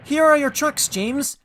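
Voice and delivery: high-pitched little engine voice; cheeky teasing. A narrator's reading. Tank engine mocking James, cheekily. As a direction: tease in a high, perky voice. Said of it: "laughing rudely".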